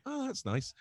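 a squeaky, deflated politeness; imitating the fan. Fan hears he's a butcher, replies in a high mock-polite voice. Bloke's reaction to butcher answer.

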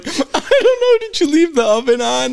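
high, loud, laughing mock excuse; feigned innocence. Friend covering up to his mom, high fake-innocent bluff. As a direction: imitate a busted kid bluffing wildly. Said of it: "we're trying to hide it".

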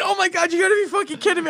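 high, loud, disbelieving yell; incredulous outburst. Retold or imitated. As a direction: act floored by her answer.

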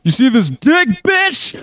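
squeaky cartoon bully come-on; hammed-up crude customer. Crude customer roleplay, pitched up.

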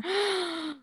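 sharp happy gasp; delighted gasp. Real, not acted. Delighted by the reviews.

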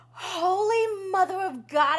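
slow, loud, high exclamation; astonished realisation. Real, not acted. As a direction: draw it out in amazement. Sudden realisation of plot twist.

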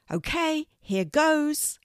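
very high, breathless "Okay, here goes"; high nervous excitement. A narrator's reading. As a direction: brace yourself and leap in. Kelty about to open chest.

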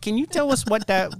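coy, batting your eyelashes; flirty sweet voice. Pretend. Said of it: "You're twirling your hair".

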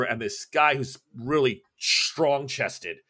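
ordinary delivery

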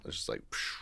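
breathy exasperated whisper. Real, not acted. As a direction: let it out on a breath, no voice. Exasperated aside.

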